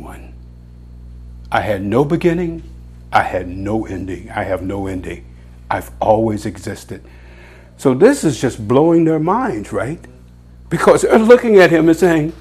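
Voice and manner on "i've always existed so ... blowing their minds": ordinary delivery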